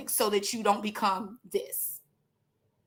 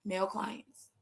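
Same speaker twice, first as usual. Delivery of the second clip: "male clients" repeated much softer, lower; soft echo; 4 semitones lower, 11 dB quieter than usual. Shown as a demonstration. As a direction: drop "male clients" to a near aside. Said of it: "if you didn't see".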